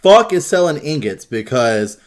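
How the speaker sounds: loud, indignant demand; retold outrage. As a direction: act out being outraged at friends. Retold outrage over sold ingots.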